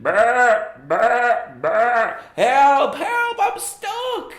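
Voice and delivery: loud, very high bleating shout for help; sheep shouting. Acted. Stuck sheep calls out, loud high bleating shout. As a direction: bleat and yell like a trapped sheep. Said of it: "And he shouted".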